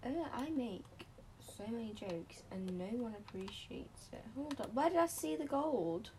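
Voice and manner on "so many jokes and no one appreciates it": muttering under breath; whispered aside. Real, not acted. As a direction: whisper it to yourself. Muttered aside after joke.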